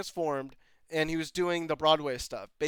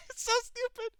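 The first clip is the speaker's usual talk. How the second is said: high, soft squeal through laughter; helpless laughter; 6 dB quieter than usual. Real, not acted. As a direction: squeeze the words out while cracking up. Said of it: "I'm happy now 'cause I'm laughing".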